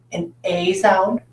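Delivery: "a" drawn out as the letter's name; long A sound. Shown as a demonstration. Teacher offering students vowel choices, long A. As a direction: say "a" slowly, rhyming with "day". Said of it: "its long A sound".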